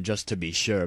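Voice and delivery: plain reading voice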